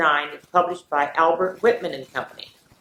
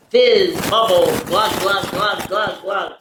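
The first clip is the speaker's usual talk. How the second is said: bubbling sounds; 3 semitones higher, 3 dB louder than usual. A narrator's reading. Sound words in picture book.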